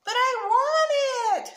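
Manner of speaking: put-on long, high, loud child's screech; drawn-out high-pitched wail of protest. A narrator's reading. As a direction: screech it like a tantruming kid. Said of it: "Sammy screeched".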